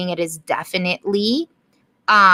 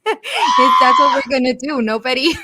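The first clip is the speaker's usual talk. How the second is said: laughing; 4 semitones higher than usual. Real, not acted. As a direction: break into laughter mid-sentence. Laughing at the quick veto.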